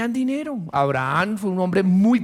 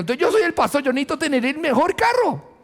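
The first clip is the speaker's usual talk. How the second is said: mock vain pastor; 8 semitones higher, faster than usual. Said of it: "yo me voy a poner yo ejemplo".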